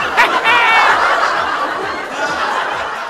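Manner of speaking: carcajada aguda y fuerte. Real, not acted. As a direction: suelta una risotada muy aguda. Se ríe de la broma. (risotada aguda)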